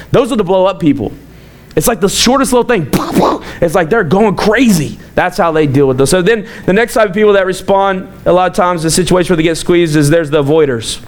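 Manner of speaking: normal talking voice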